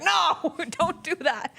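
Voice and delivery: high, loud cry of no then a laugh; alarmed friend blurting no; urgent no. Retold or imitated. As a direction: stop her fast, half laughing. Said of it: "And Crystal's like".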